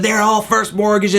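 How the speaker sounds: high-pitched mock yell of an irate caller; high, worked-up mock scream; retold screaming. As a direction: imitate someone screaming down the phone. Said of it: "is screaming".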